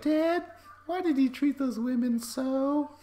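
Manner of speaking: put-on high, slow little-kid voice; high-pitched child questioning a parent; child's voice. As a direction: play a puzzled young kid asking his dad. Kid voiced asking dad.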